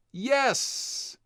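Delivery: put-on high, bright kid's yes; eager yes. Kids answering their cousin, high eager yes.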